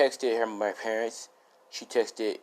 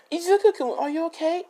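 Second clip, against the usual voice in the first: put-on much higher worried girl's voice; 3 dB louder than usual. Girl rushing to hurt Izuku, high and worried. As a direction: pitch way up as the anxious girlfriend.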